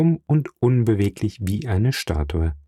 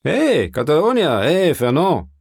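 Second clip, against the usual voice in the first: high, swooping hail; calling out; 6 semitones higher, 4 dB louder than usual. A narrator's reading. Drinker hails a friend running past, calling out. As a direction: call him back from a distance. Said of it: "rief eine Stimme".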